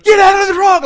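very high, loud yell; urgent shout. Retold or imitated. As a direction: scream a warning at the guys in the runaway truck. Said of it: "I yell at these guys".

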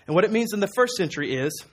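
ordinary delivery

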